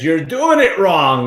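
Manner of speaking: Tony on stage calling out the crowd, mock stern; imitating Tony. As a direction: lay it out slowly like a coach catching you out. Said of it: "And he says".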